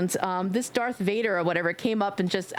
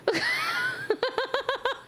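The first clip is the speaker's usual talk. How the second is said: long high laugh. Real, not acted. Laughs at potato joke.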